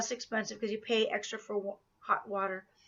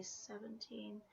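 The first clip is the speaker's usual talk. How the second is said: usual speaking voice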